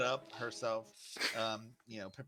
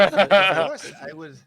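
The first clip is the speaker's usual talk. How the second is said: laughing reply; 18 dB louder, much slower than usual. Acted. Cook answers laughing.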